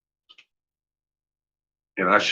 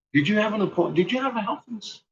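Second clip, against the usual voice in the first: higher, softer voice for the kind worker; Gretchen, concerned; 10 semitones higher, 4 dB quieter, faster than usual. Retold or imitated. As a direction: lift the voice and ask gently, worried. Gretchen voiced asking questions.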